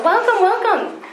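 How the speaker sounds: high, slow, sing-song welcome; warmly calling out to late arrivals; bright welcome. Real, not acted. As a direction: stretch it out to greet latecomers. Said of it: "greets latecomers mid-sentence".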